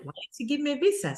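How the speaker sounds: mildly miffed retold question, high and quick; mildly indignant. Newcomer recalling being kind of mad over the visa, mildly indignant. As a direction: pitch it up in mock indignation. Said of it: "I was kind of mad".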